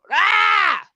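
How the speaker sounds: short, loud "ah!"; mock scream. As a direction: yelp it high as if panicking in the water. Mimics a shark-attack scream.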